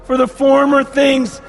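preacher thundering the promise of a new heaven; proclaiming scripture. Acted. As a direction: declaim each phrase from the throne. Proclaims scripture at full force.

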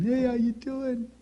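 put-on "Hey, how you doing?" said high and slow, like a first try at a baby; slow, lifted baby greeting; playful high hello to make a baby smile. Shown as a demonstration. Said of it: "when you change the tone of your voice".

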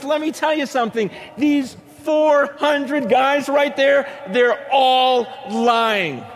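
high, loud, building to drawn-out words; forceful denunciation. Retold or imitated. Micaiah exposing the four hundred prophets to the king, loud denunciation. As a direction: denounce them high and loud, stretching the final words.

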